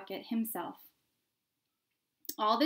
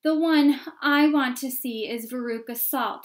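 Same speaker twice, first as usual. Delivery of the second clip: very loud, higher crowd shout; 3 semitones higher, 11 dB louder than usual. A narrator's reading. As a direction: shout over everyone else. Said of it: "shouted another voice in the crowd".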